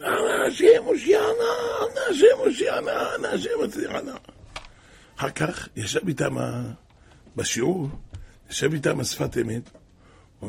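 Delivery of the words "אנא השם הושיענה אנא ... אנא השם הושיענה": high, wailing plea to God; imitated crying out. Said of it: "הם היו זועקים". (זעקה גבוהה ומתחננת, בחיקוי)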